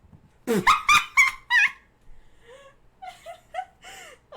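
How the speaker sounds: high squealing burst of laughter; shrieking laugh. Real, not acted.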